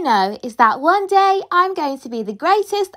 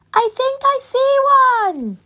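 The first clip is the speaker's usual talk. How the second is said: high, eager hushed call; excited hopeful spotting; 10 semitones higher than usual. Acted. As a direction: point at the sky hopefully. Spotting a meteor in the sky.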